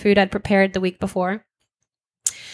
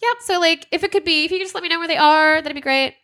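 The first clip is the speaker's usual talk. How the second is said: high, fast, strained customer-service politeness; forced politeness; 7 semitones higher than usual. Retold or imitated. Holding back tears, she stays high and fast and polite on the phone. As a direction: sound sweet while barely holding it together. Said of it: "Keep it together. You're on the phone".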